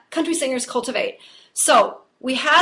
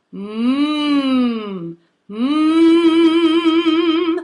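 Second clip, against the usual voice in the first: humming "mm" buzzing in the nose, swelling louder; buzzy nasal hum; 2 semitones higher, 5 dB louder than usual. Shown as a demonstration. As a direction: show the tickly hum in the nose. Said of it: "it's all tickly in the nose".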